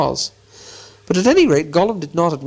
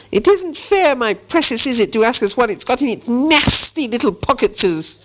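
high, aggrieved creature voice; Gollum; 10 semitones higher than usual. A narrator's reading. Gollum objects to Bilbo's pocket question, aggrieved hiss. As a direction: complain high, hissing on the esses. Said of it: "he hissed".

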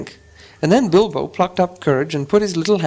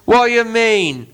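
higher slow troll demand; 8 semitones higher, 4 dB louder, much slower than usual. Acted. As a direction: growl it suspiciously. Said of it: "said Bert".

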